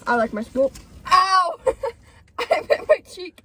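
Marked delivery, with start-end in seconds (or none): 1.1-1.6 real high, loud "ow"; pained yelp. Real, not acted. Girl who just hit her cheek, pained yelp. 1.6-3.4 high-pitched, helpless laughing words; laughing through pain. Real, not acted. Girl telling friends she hit her cheek, pained laughing. Laughing after hurting herself.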